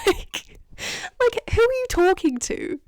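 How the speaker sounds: high, laughing incredulous question; amused disbelief. Real, not acted. A fan laughs at who Arthur is addressing, incredulous. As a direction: laugh at the absurdity. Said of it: "incredulous laughing remark".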